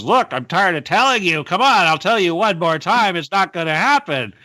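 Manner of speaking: hammed-up exasperation; exasperated. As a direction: act out someone fed up with repeating themselves. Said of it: "being exasperated".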